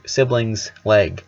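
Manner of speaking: usual speaking voice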